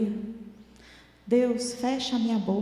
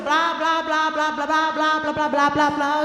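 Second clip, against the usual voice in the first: louder droning nag, blah after blah; mock nagging drone; 2 semitones higher, 6 dB louder than usual. Mother mocking her own nagging at the kids, loud monotone drone. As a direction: rattle it off like endless scolding.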